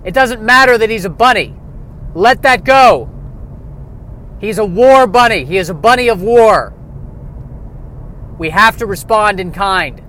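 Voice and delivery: hammed-up alarmist politician; mock senator. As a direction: pound the podium about the bunny. Senator hearing, mock speeches.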